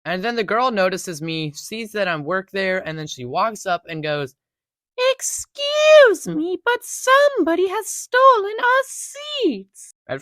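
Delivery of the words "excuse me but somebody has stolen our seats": very high, slow, odd put-on voice; weird voice. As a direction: squeak it out in a strange silly voice. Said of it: "she said it in that voice".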